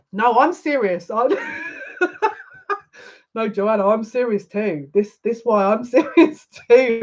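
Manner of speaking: trying to be earnest and cracking up; laughing insistence. Real, not acted. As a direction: insist through real laughter. Insisting she is serious while laughing.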